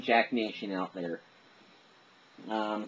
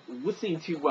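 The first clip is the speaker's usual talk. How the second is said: usual speaking voice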